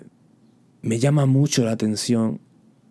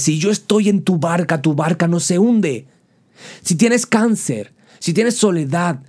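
fervent preaching; 5 semitones higher than usual. Acted. Preacher assuring the congregation Jesus is aboard, fervent. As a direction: preach it with rising fire.

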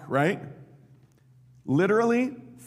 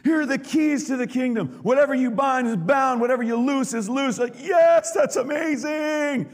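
excited retelling; 11 semitones higher, 6 dB louder than usual. Retold or imitated. Imagined Peter hearing Jesus.

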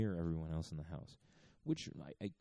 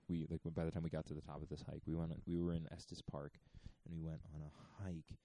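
ordinary delivery

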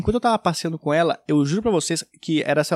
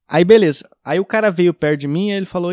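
usual speaking voice

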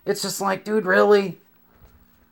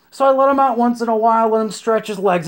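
fast defensive; 5 semitones higher, faster than usual. Retold or imitated. Rushed defence.

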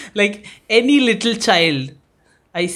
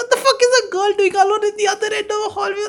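high, fast freaked-out rant; mock freaked out; 14 semitones higher, much faster than usual. Real, not acted. Ranting about a creepy girl in a dark hallway, freaked out. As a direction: spill it out in panic.

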